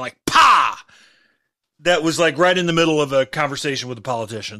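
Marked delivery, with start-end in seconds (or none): 0.3-0.8 loud sharp pa like a gunshot; gunshot sound. Retold or imitated. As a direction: bark out a gunshot noise. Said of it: "it was like this gunshot sound".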